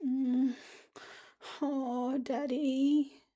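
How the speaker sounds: put-on high, small little-girl voice; high baby voice calling for Daddy; inner child baby voice. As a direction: talk like a tiny child reaching for her dad. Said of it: "that's how my inner child sounds".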